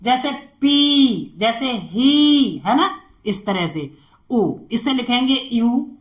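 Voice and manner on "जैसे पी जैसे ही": खी, पी, ही drawn out slowly; slow matra demo. Shown as a demonstration. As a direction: stretch the long ई in खी, पी, ही. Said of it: "ई का sound आएगा". (लंबी ई धीरे खींचकर)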